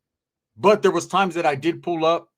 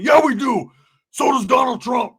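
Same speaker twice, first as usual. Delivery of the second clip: 1 mock shout; 7 semitones higher, 5 dB louder than usual. Friend shouting back.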